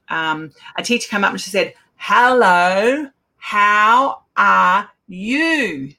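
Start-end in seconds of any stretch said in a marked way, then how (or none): 2.0-5.9 drawn-out, over-mouthed and louder, every syllable stretched; exaggerated slow speech. Teacher greeting the deaf daughter, hugely slowed and overarticulated. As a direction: talk like she thinks she can't understand you. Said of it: "speak like this".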